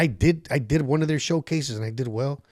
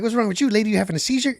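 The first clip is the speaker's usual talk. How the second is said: mocking heckler; 8 semitones higher, much faster than usual. Comic mocking heckler on stage.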